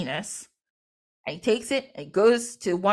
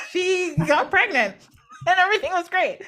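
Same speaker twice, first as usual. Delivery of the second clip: high, laughing delivery; real amusement; 10 semitones higher, 3 dB louder than usual. Real, not acted. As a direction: tell it while cracking up, voice pitched up. Cracks up telling the pregnancy.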